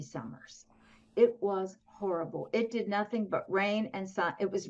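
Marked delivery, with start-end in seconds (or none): none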